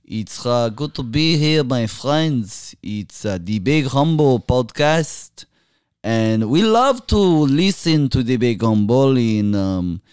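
put-on Middle Eastern accent, lilting pitch. Guest greeting podcast listeners on request, put-on Middle Eastern accent.

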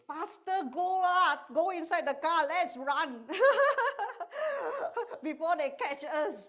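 high, loud panicked urging, laughing; panicky rush. Retold or imitated. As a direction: hurry everyone out in a panic. Father fleeing the bill.

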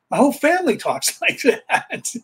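high-pitched, laughing through the words; laughing reply. Retold or imitated. Telling baffled coworkers about his family, amused and laughing. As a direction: break into a laugh mid-phrase.

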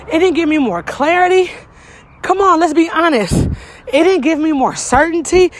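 rising, impassioned preacher cadence; preaching. Acted. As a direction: build it up like a sermon. Builds into preaching.

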